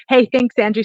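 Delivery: usual speaking voice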